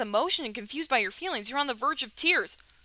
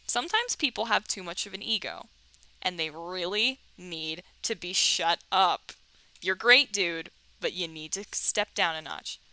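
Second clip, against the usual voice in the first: ordinary talk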